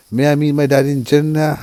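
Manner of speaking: ordinary talk, nothing marked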